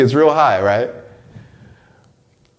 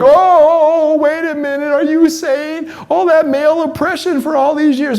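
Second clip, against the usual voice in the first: much higher, mocking shocked voice; mock offended listener. Mocking critics who hear this talk, high shocked voice. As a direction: ham up a scandalised critic. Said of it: "people hear this conversation and they're like".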